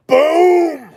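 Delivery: high, loud shout of boom; showing off biceps for the viewers, with a loud boom. Real, not acted. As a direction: slam the word out like a flex.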